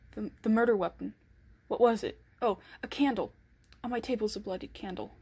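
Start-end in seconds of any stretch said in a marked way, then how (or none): 0.1-3.3 louder, breathless panic over the evidence; rising panic. Acted. Killer panicking as police may come, louder frantic voice. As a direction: blurt it out as fear takes hold. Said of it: "I begin to panic".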